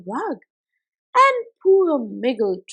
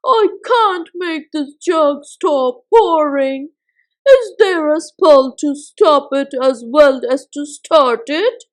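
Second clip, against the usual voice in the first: pleading cry; 9 dB louder than usual. Acted. Miggle begging Mother Tick-Tock to stop the jug. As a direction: beg for help in a panic.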